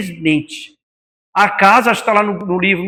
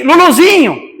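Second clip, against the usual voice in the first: tender call to the dog; 6 semitones higher, 3 dB louder than usual. Retold or imitated. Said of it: "Já pensou se eu falasse pra ele assim".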